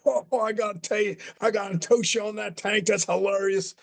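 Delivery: high, put-on voice of an amused wrestler; JBL. Wrestler wants to toast his colleague after the slap, imitated delight. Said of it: "And J B L's like".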